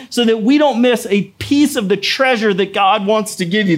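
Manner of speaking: usual speaking voice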